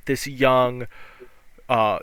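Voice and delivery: usual speaking voice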